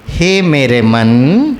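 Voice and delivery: low, slow, lingering address; loving, tender. Shown as a demonstration. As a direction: coax the mind gently with love. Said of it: "थोड़ा प्यार मोहब्बत से बोलिए". (प्यार मोहब्बत से, धीमे)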